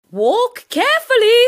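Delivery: high, loud teacher voice; teacher calling a caution; teacher's warning. A narrator's reading. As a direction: warn the children to take care. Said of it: "said the teacher".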